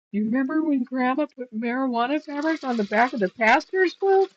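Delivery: teasing outrage building to the end; exasperated. Retold or imitated. Daughter scolding mom, retold.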